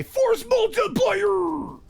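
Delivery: high, drawn-out mock announcer call; hammed-up announcement. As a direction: stretch it out like a big show intro. Hammed-up buzzword intro.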